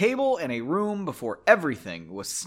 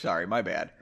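plain reading voice

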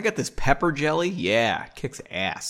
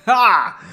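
loud, high mock "ha"; acted laugh; 8 semitones higher, 9 dB louder than usual. A narrator's reading. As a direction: bark out one fake laugh.

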